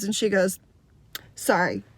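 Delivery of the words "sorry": short, low, grudging sorry; insincere apology. Retold or imitated. Girl made to apologize to the others, insincere and curt. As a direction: toss off an apology you don't mean. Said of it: "I don't think you meant that".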